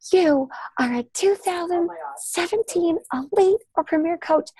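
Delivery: high, slow hype-announcer voice; high, slowed mock award call. Mock Zoom elite rank announcement.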